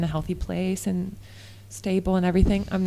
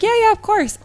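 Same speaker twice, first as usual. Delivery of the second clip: mock co-leader; 13 semitones higher, 6 dB louder than usual. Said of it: "he was like".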